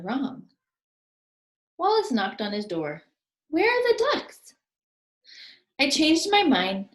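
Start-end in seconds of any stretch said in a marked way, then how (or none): 3.5-4.5 high, louder puzzled voice; Wallace's alarmed question. Acted. Wallace asking Albert at his door, high and alarmed. 5.8-6.9 a bit higher and louder brag; boastful. Acted. Albert boasting to Wallace, higher and proud. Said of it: "Albert boasted".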